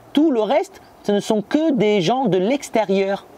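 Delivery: normal talking voice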